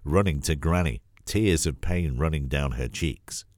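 usual speaking voice

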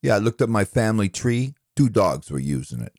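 usual speaking voice

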